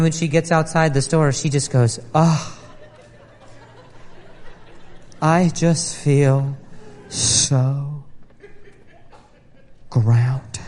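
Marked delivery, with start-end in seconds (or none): 2.1-10.6 slow, lower, blissed-out drawl; mock serene spiritual voice; dreamy wife. Wife mimicked after rock shopping.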